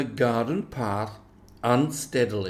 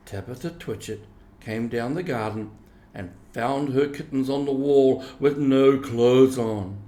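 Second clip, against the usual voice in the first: plain reading voice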